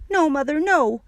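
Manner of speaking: put-on high, loud child's protest; alarmed shout. A narrator's reading. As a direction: cry out to stop your mother. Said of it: "I shouted".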